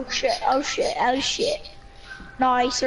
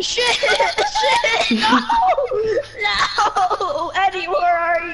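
panicking gamer; 8 semitones higher, 4 dB louder, much slower than usual. Real, not acted. Getting killed, laughing panic.

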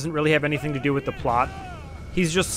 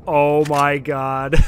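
slow, stunned oh my god; drawn out in disbelief; awed gasp; 5 dB louder, much slower than usual. Retold or imitated. Retelling shock at neck break.

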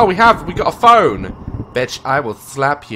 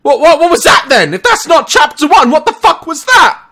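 shocked; 11 dB louder than usual. Real, not acted. Shocked after jump scare.

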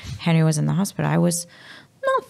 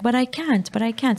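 higher-pitched child's plea, lightly imitated; retold near-tears protest; 6 semitones higher than usual. Mother quoting her son who cannot cry, mild high-pitched plea. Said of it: "want to cry".

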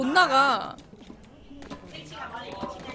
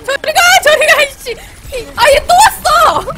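shrieking high and loud in panic; panicked yelling; 10 semitones higher, 11 dB louder than usual. Real, not acted. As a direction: yell to chase it away, frantic. Enemy chasing her in game.

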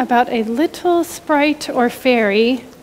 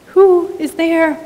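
usual speaking voice

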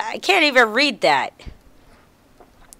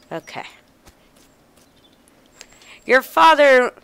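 ordinary talk, nothing marked

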